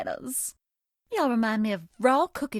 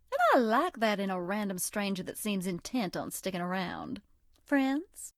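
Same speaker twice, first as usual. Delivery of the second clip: put-on a coy Southern girl sweet-talking a new friend; Southern belle character. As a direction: play the sweet, teasing Southern charmer. Voiced game character Bonnibel lines.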